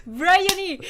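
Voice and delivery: high, loud cry of the name; loud, high-pitched exclamation; excited call-out. Real, not acted. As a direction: call out to grab a friend's attention. Excitedly calling her co-host's name.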